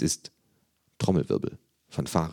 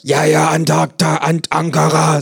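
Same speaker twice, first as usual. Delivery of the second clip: put-on higher, louder, slow old Berlin busybody; nosy neighbour; 11 semitones higher, 9 dB louder than usual. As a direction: squawk like a gossiping neighbour. Neighbour voiced in story.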